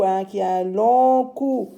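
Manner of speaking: normal talking voice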